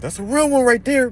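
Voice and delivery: high, loud yell of approval; screaming praise. Retold or imitated. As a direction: shout it across the street at full pitch. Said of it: "He's screaming at the top of his lungs".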